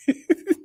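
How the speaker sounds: a burst of high giggling; real high giggle; high laugh. Real, not acted. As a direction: let a high laugh escape. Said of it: "get me laughing".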